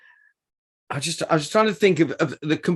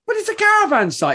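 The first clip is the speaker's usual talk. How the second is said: astonished, as if it makes no sense; incredulous retort. Retold or imitated. Telling the bouncer a caravan site can't be rough, incredulous. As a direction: pitch leaping much higher in disbelief. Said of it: "And I said".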